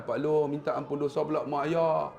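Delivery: usual speaking voice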